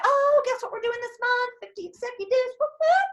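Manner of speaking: high sing-song announcing, cheering whoop; mock hype. Announcing the monthly goal to the team, high sing-song hype. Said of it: "You're gonna talk about it".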